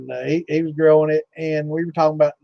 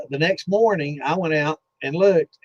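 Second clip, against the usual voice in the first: ordinary delivery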